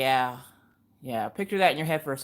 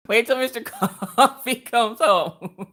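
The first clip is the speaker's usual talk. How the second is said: laughing; 5 semitones higher, 3 dB louder, slower than usual. Real, not acted. Laughing at the card.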